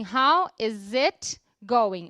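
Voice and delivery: very slow "How is it going" with a buzzing z in "is"; "How is it going" drawn out, "is it" run together as "izit"; S pronounced as Z. Shown as a demonstration. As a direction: stretch out "How is it going", linking the z of "is" onto "it". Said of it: "falar esse S do is com a pronúncia de Z".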